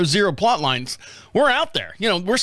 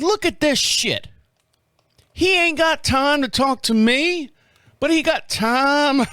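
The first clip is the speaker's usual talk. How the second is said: higher, slow Southern drawl; 8 semitones higher, slower than usual. Retold or imitated. Griping that the neighbor ignored him, in a Southern drawl. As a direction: stretch it out in a Southern drawl, indignant. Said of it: "Somehow I got some kind of southern drawl here".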